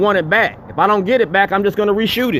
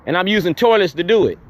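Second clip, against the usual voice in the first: ordinary talk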